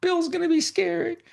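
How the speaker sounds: put-on a small kid fretting that his boat is gone; panicked little Georgie. Georgie frets about his lost boat to himself, high child voice. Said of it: "He's like".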